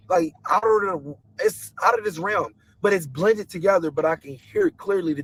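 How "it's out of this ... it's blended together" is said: ordinary talk, nothing marked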